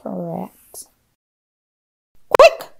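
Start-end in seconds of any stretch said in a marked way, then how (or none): 2.3-2.7 shrieked high and loud; witch's cry. A narrator's reading. Witch warns the monsters the children are coming, shrieking. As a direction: sound the alarm in a piercing witch cry. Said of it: "cried the witch".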